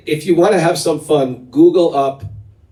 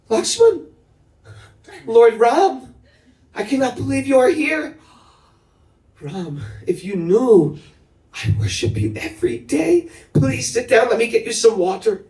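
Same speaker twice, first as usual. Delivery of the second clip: much higher, breathless joyful welcome with a laugh; stunned and overjoyed; 10 semitones higher than usual. Retold or imitated. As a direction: greet the god you waited years for. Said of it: "Shabri just was stunned".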